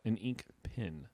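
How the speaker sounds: "pen" drawn out slowly like pin. Real, not acted. Repeating it for a puzzled friend, "pen" said like pin. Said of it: "A pin or a pen?".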